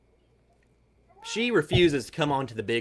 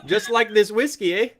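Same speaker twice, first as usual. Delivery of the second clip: gushing, higher-pitched mock Canadian, half laughing; super-friendly Canadian accent; 4 semitones higher than usual. Whiskey taster coached to be nicer, overly friendly Canadian accent. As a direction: pour on the niceness in a Canadian accent. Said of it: "do it in a super friendly form".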